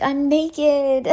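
high, pleading "I'm naked"; whiny excuse. Retold or imitated. Explaining to the roommate, a high whiny excuse. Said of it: "And I was like".

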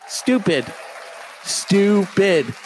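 exasperated self-rebuke. Real, not acted. Self-scolding outburst.

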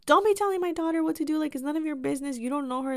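fast, high, scolding mom imitation; mama bear. Mom defending daughter from a stranger, mama-bear scolding. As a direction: tell someone off in a protective mother's rush. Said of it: "my mom came in like Mama Bear".

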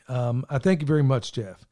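usual speaking voice

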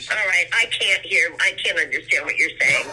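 loud, straining to be heard; shouting into a speakerphone; yelling across the room. Real, not acted. Mom on bad phone line.

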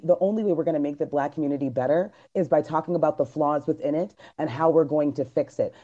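usual speaking voice

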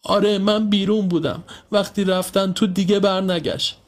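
put-on high, crying child's account; tearful. A narrator's reading. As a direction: plead it through mock tears. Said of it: "پریا گریه کنان گفت".

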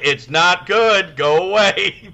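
slow, loud high yell breaking into a laugh. Retold or imitated. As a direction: bellow it while cracking up. Retold yelling at coworker.

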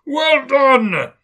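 big booming jolly merchant, loud and high; oily, rich, fat, jovial voice. Acted. Fezziwig calls his apprentices, loud and jovial. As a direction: boom it out like a fat, merry old boss. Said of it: "cried out".

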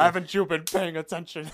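loud, high mock scolding; mock outraged shout. Teasing her co-host for the mistake, mock shouting. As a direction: yell it like a shocked teacher.

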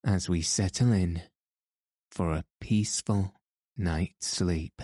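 calm them gently toward sleep; sleep story narrator. Acted. Sleep podcast host intro.

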